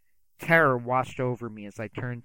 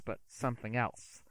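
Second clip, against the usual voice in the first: usual speaking voice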